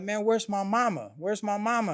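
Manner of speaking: retold puzzled alarm; worried searching. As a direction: re-enact a son urgently asking around for mom. Searching for his missing mother.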